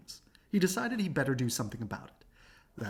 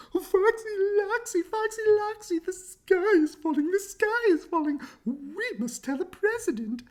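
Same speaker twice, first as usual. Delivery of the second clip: much higher panicked hen cry; clucking hen; 6 dB louder than usual. Acted. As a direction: squawk it out like a frantic chicken.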